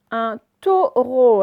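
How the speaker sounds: "taureau" slow and loud, both O sounds held; syllable by syllable. Shown as a demonstration. Teacher showing O heard twice, syllable by syllable. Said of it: "je l'entendais deux fois".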